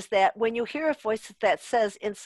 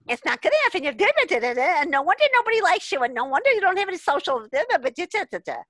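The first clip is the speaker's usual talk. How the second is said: higher, louder, fast nagging inner critic; harsh critical inner voice; 7 semitones higher, 4 dB louder, much faster than usual. Retold or imitated. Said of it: "That's a constructive voice. This voice is".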